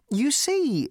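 plain reading voice

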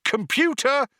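high, loud call to attention; shout. A narrator's reading. Zaphod calls to the ship's computer, shouting. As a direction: shout it out, pitched right up. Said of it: "shouted Zaphod".

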